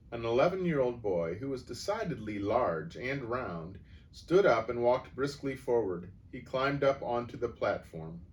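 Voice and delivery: ordinary delivery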